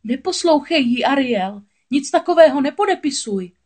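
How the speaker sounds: loud, high, alarmed plea; urgent warning. A narrator's reading. Crab warning Ariel not to sign, urgent. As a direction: call out forcefully to stop her. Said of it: "varoval malou mořskou vílu Sebastián".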